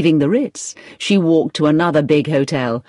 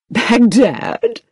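startled, higher-pitched echo; great surprise; 6 semitones higher, 3 dB louder than usual. A narrator's reading. Agency manager hears an unlikely request, great surprise. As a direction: repeat the place in astonishment. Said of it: "said the manager in great surprise".

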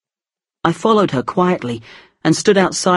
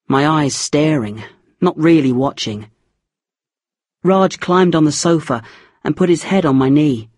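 ordinary delivery, nothing marked

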